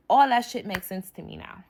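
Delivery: normal talking voice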